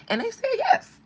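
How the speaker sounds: squeaky high thrilled "yes"; high excited yes; delighted yes. Real, not acted. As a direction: ring out the yes in disbelief. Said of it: "excited retelling of venue's answer".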